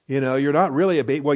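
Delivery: ordinary delivery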